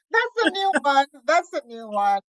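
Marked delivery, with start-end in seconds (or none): 0.0-1.1 laughing through the words; amused laugh on the line. Real, not acted. Laughing at the Jafaican nickname.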